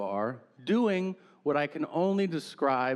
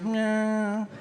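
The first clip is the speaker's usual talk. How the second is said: high, held whiny noise; imitated odd whine; 8 semitones higher than usual. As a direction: stretch one high, catlike note. Imitates neighbor's wordless reaction again.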